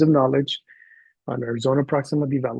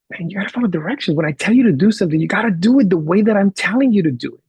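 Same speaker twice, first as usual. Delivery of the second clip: louder, higher, fast telling-off; insistent scolding; 6 semitones higher, 5 dB louder than usual. Retold or imitated. Teacher lecturing boy about following directions, insistent and fast. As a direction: re-enact a teacher laying down the rules.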